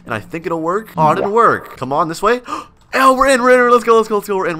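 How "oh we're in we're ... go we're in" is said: high, loud excited rush. Real, not acted. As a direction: celebrate getting in. Gets into the house.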